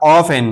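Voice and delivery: often said slowly as "off-en"; silent T. Shown as a demonstration. Teacher introduces the word to learners, often with silent T. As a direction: say often with no T sound. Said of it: "बल्कि टी silent".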